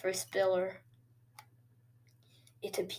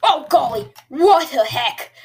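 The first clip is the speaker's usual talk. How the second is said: accidental Australian accent; 4 semitones higher, 14 dB louder than usual. Real, not acted. Gamer startled by the play, loud exclamation with an Australian tinge. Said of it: "Did I sound like an Australian".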